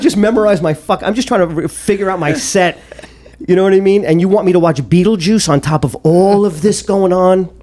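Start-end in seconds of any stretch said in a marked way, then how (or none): none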